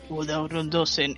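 lightly done deep, slow announcer voice; dramatic voiceover. Retold or imitated. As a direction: drop low and drag the name out. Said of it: "y es como, por favor".

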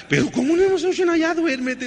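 high, loud, exasperated dad snapping back; fed-up father, pitch jumping way up; exasperated parent. Retold or imitated. Tired dad to his kids: exasperated high outburst.

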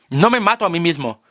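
high, insistent yell; shout. A narrator's reading. Narrator shouts his sudden realization, high yell. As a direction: declare it at full voice.

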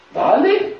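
high, loud go-ahead; urging cheer. Retold or imitated. Sandra tells him to go for it, urging.

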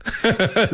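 high little laugh. Real, not acted. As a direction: laugh fondly. Laughing at daughter story.

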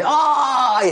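high, laughing envious "¡Ay!"; mock envious cry. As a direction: squeal it like jealous coworkers. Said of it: "dicen, pues". (grito de envidia burlón y agudo)

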